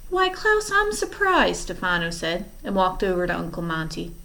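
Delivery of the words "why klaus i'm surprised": very high, sing-song fake surprise; mock him with false surprise. Villain mocking the boy.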